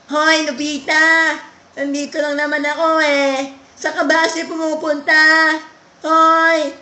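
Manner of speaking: high loud cartoon voice. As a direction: call out like an excited cartoon. Cartoon voice calling.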